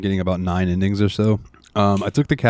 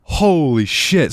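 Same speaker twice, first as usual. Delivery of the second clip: slow, drawn-out amazed holy shit; awed and slow; stunned; 8 semitones higher, 3 dB louder, much slower than usual. Real, not acted. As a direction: let it hang in disbelief. Reacting to wild ending.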